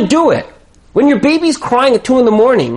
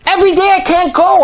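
pleading, much higher; 11 semitones higher, faster than usual. Shown as a demonstration. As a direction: voice lifted high in anguish. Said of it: "repeated line".

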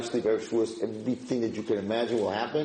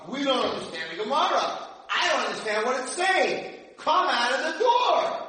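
screaming in frustration; 14 semitones higher than usual. Retold or imitated. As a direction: shout to get the answer out. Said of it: "it's like we're screaming".

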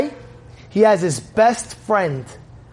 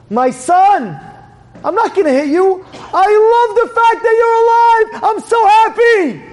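building to a high, loud cry; acted high-pitched, overwhelming joy; overjoyed father; 14 semitones higher, 5 dB louder than usual. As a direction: shout with relief and love. Father overjoyed son is alive.

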